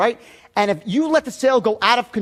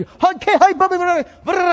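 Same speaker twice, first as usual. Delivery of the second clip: much higher, louder, burst of eager sales chatter; mock rapid patter; 9 semitones higher, 4 dB louder than usual. Top BMW saleswoman greets a customer, mock excited patter. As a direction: rattle it off like an unstoppable saleswoman.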